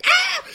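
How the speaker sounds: short, loud high-pitched mock shriek; imitated baby scream. Dad mimicking his daughter's demanding scream, shrieked. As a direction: imitate a baby's sudden scream. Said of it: "Just screams".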